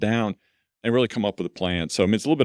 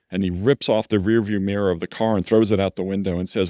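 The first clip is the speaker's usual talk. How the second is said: usual speaking voice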